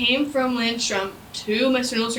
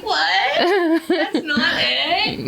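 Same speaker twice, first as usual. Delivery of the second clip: laughing protest; 5 semitones higher, 4 dB louder, much slower than usual. Retold or imitated. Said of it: "I was that one girl that was like".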